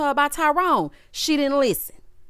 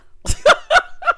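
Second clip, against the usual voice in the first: loud, high burst of real laughter; shrieking laugh; 6 dB louder than usual. Real, not acted. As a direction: cackle out loud at the joke. Laughing at Kandi's threat.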